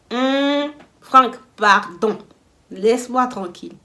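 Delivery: loud, drawn-out mock annoyance; annoyed rebuff. Telling Franck to leave her alone at night, annoyed rebuff. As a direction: push him off with slow, loud words.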